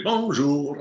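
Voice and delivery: put-on playful French accent on the greeting. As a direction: give it a very French lilt. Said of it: "I come in very French".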